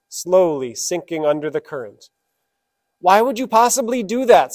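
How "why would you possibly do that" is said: high, loud anguished cry; a dying frog screaming at the scorpion who stung him; frog's scream. Acted. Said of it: "screamed the dying frog".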